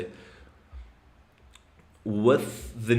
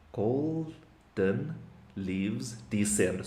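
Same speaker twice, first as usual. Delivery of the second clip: slow, soft, syllable by syllable; hushed sparse haiku; 7 dB quieter, much slower than usual. Shown as a demonstration. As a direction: spell out each syllable slowly and softly. Reads again, counting syllables.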